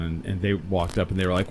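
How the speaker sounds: ordinary talk, nothing marked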